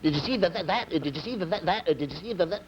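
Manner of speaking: high, insistent stammer on a loop; comic stutterer piling up repeated words; bad stutter. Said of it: "and the guy starts again".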